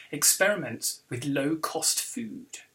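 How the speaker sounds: ordinary delivery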